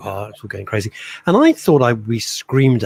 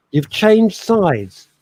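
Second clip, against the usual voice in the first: drawn-out teasing; 6 semitones higher, 5 dB louder, much slower than usual. Real, not acted. Teasing co-host about screen side.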